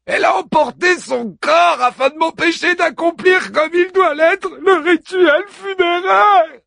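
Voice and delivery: very high, loud wail, slowing at the end; despairing shout, stretching the last words; anguished cry. Acted. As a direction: hero howling over his lost friend. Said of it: "s'écria Achille inconsolable".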